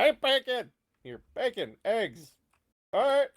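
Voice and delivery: high-pitched kitchen order yell. Acted. Barkeep yelling an order back to the kitchen, yelling. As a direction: call it out in short, high bursts. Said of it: "He's yelling at somebody".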